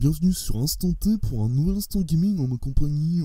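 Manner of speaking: high, loud put-on show host voice; booming comic TV-presenter parody; Les Inconnus voice. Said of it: "je vais arrêter de prendre la voix des, des inconnus".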